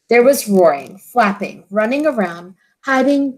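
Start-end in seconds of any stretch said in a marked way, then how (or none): none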